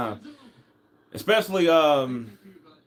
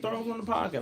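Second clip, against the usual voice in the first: high and softer repeat; 10 semitones higher, 6 dB quieter than usual. Retold or imitated. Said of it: "the emphasis on".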